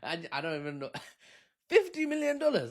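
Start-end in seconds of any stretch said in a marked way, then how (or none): 1.7-2.7 high-pitched mock Chinese accent; put-on Chinese accent. Repeating the payout offer, put-on Chinese accent. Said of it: "I've gone Chinese".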